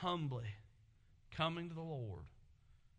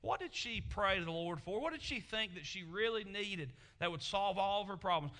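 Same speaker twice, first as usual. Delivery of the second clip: ordinary talk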